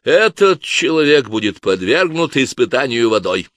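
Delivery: loud solemn verdict from the inquisitor; solemn proclamation. A narrator's reading. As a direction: proclaim it to the hall like a judge. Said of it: "торжественно провозгласил".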